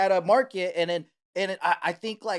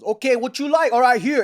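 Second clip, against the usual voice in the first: higher, louder brisk counterman; helpful deli guy; 7 semitones higher, 8 dB louder than usual. Retold or imitated. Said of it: "And I goes".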